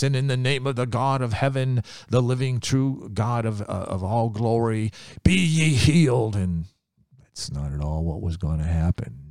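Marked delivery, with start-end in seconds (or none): 0.0-6.3 grand prophet voice. Retold or imitated. Naaman imagining the prophet healing him: lightly hammed-up grand blessing. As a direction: intone it like a mighty prophet. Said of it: "He'll speak in a voice that you'll never forget".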